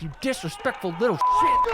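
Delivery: high, loud mock indignation; imitated outrage. Commentator voicing player who tosses ball at rival, mock fury. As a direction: spit it out as a mock-furious insult.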